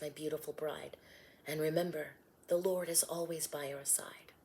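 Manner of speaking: calm her down gently, almost whispering; Joseph soothing. Acted. Joseph soothing Mary at night.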